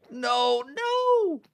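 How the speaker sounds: very high, loud squeal of no; panicked shriek. Real, not acted. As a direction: shriek as they come at you. Mobs attacking her again.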